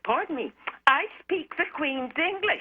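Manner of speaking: retold indignant retort. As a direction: reenact standing up for yourself. Indignant retort to accent critic.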